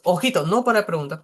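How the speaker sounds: ordinary delivery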